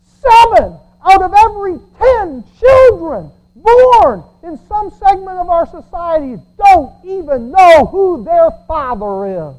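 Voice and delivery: loud, high, slow preaching peak; fiery preaching. Acted. Preacher decrying fatherless homes, loud fiery preaching. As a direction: shout the statistic to the church.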